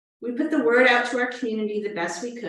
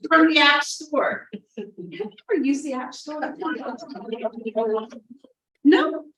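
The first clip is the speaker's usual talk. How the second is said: patient tech helper; 6 semitones higher than usual. Acted. Acting out virtual tech help.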